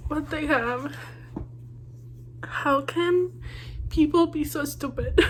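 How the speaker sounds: tearful, hushed frustration at the characters; real crying. Real, not acted. Reader telling viewers about a looming breakup, crying. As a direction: talk through tears, with halting pauses. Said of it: "And I'm crying".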